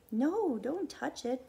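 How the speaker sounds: put-on boy begging the woman not to touch his foot, frightened; frightened plea. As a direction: beg her not to touch the hurt foot. Boy in pain fearing touch.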